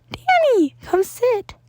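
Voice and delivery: high, bright little principal voice; a cheerful small creature greeting a student; high Nezu voice. Acted. Principal Nezu welcomes Danny into his office, in a high cheerful voice.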